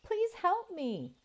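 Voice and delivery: high, pleading calf voice; wounded calf cry. Acted. Robot mimics a wounded calf to lure wolves, high and pleading. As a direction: beg like a hurt calf in the dark. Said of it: "crying out in a sad voice of a wounded calf".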